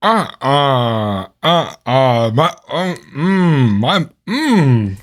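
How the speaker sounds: stuck on "uh", too disgusted for words; grossed-out stammer. Real, not acted. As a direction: sputter in disgust, can't form a sentence. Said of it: "I am too grossed out to even speak".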